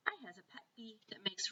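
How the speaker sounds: hushed whispered reading. Acted. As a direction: whisper it like a secret. Reading dream label aloud.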